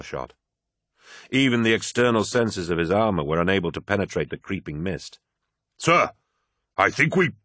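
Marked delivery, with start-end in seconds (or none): 5.8-7.3 alarmed, cut off. Acted. Soldier cut off by attack.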